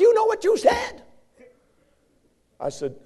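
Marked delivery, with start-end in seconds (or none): 0.0-1.0 high, loud accusing squeak; high squeaky professor. Retold or imitated. Professor accusing the student of cursing. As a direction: point the finger in a tiny man's squeal. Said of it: "He said".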